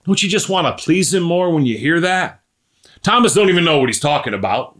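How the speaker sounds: ordinary delivery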